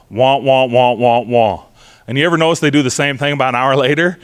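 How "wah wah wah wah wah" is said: droning mock wah-wah, like a muffled trumpet; wah wah adult noise. Parent lecturing a melted-down kid, heard as mock wah-wah drone. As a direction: imitate a grown-up droning on, all noise, no words. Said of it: "what they're hearing from you".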